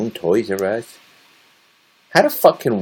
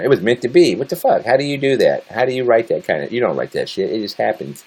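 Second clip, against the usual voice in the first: usual speaking voice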